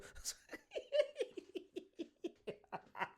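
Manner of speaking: high, breathless giggling; long laugh. Real, not acted. Reactor cracking up at his own terrible accent, long laugh.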